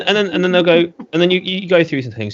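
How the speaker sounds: ordinary delivery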